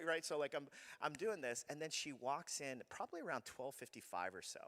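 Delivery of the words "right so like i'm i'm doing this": ordinary talk, nothing marked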